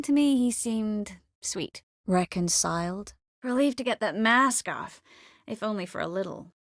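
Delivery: normal talking voice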